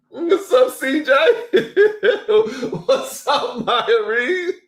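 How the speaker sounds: high, loud greeting through laughter; laughing greeting. Real, not acted. Host greeting chat members while laughing, high and loud. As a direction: laugh while calling out names.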